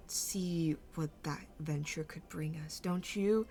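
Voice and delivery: hushed, swooping villain musing; menacing Mauve. Acted. Mauve proposes a monster experiment to the group, hushed and lilting. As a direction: savour the threat with lilting pauses. Game master voices Mauve.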